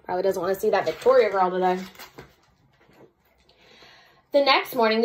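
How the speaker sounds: plain reading voice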